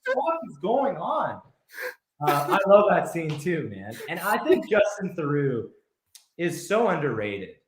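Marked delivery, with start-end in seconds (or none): 0.1-1.4 high-pitched incredulous reaction; acted-out bafflement. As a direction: squeal it like a stunned viewer. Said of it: "You're like".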